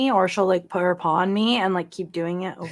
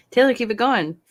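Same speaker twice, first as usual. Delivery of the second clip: a touch of Cockney, higher and louder; mock Cockney accent; 5 semitones higher, 5 dB louder than usual. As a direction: keep the Cockney bit rolling. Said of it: "a London accent or a Cockney accent".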